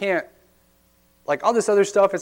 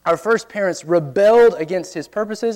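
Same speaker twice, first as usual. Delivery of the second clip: ordinary delivery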